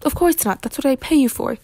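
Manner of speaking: indignant, put-upon employer; snippy refusal. A narrator's reading. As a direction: snub the hired help. Employer snubs gardener's request.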